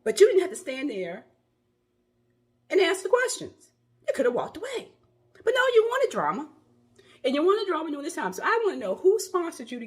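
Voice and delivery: rising indignant scold, high and loud; angry scolding. Real, not acted. Vlogger scolding the reporter who kept asking: heated, loud. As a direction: lash out at the reporter.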